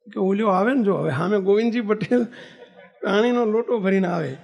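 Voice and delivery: usual speaking voice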